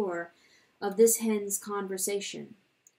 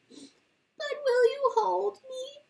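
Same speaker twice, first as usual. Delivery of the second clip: high, slow, tearful little mouse; wee crying voice; slower than usual. Acted. The little mouse begs the hen to hold him, high and tearful. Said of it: "the wee voice asked".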